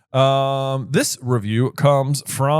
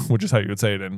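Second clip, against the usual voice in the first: normal talking voice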